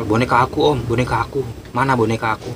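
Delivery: usual speaking voice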